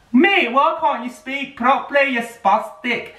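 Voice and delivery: high put-on taunting voice; mock a rude bloke who talks down. Mocking someone who can't speak.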